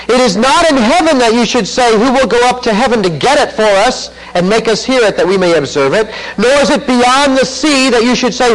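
preacher reading Deuteronomy with force; forceful scripture reading. Acted. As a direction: proclaim the verse to the hall.

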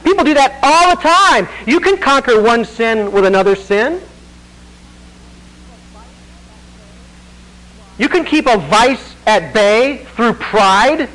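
loud, high, driving sermon delivery; forceful preaching. Acted. Preacher pressing the congregation, loud and forceful.

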